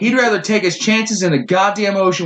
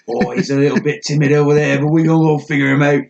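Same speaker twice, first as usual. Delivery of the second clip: mock Australian wildlife presenter; put-on Australian accent, casual bravado. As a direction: play an Australian croc hunter sizing up an animal. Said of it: "going all the way Australian on this".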